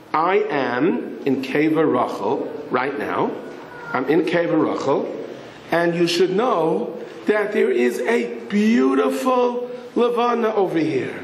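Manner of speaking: slow, measured, spaced-out announcement; soldier answering. Retold or imitated. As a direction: announce it slowly, word by word. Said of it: "one soldier answers, he says".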